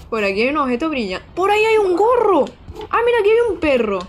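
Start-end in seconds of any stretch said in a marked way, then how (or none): 1.3-4.0 high, loud calls of finding things; shrieking with delight at each find; excited discovery. Real, not acted. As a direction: point it out, thrilled. Spotting items excitedly.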